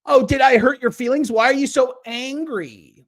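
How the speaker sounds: high, loud sing-song sneer; mocking taunt. Mocks the critic's taunt.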